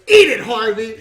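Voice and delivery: loud snapped insult; angry shout. Retold or imitated. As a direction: bark it at him. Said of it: "He goes".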